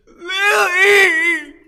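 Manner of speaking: high, loud, breaking cry of a name; anguished cry. Acted. Old man crying out for Lily, loud and anguished. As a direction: wail the name of a lost love.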